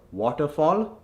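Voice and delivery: waterfall with forward rounded "o" in both halves; Indian accent. Shown as a demonstration. Coach repeats the word to convert, Indian accent. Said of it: "from the Indian accent".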